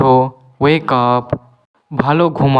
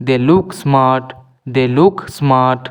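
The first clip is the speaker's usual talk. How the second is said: slow model reading; much slower than usual. Shown as a demonstration. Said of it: "teacher models the sentence slowly".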